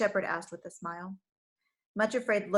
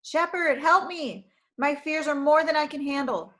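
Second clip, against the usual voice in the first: raised, urgent call; calling for help; 6 semitones higher, 5 dB louder than usual. Acted. Frightened girl calling the shepherd for help, urgent call. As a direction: cry out for rescue. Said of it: "She called to the shepherd".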